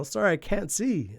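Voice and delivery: high, slow, innocent excuse; drawn out like a false apology; Raven's fake apology. Raven excusing himself to Siegel, high and mock innocent.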